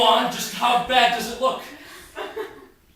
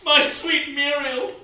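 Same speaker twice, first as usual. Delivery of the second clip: hammed-up cry of grief; melodramatic anguished cry; 7 semitones higher, 5 dB louder than usual. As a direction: wail it like a soap opera. Hears wife is dead.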